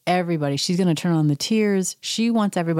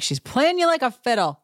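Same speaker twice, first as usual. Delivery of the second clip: shouting at the TV; 8 semitones higher than usual. Real, not acted. Yelling at TV.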